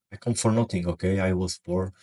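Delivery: usual speaking voice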